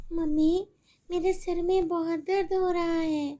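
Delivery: put-on a little girl begging her mother for help; pleading little girl. Sick daughter.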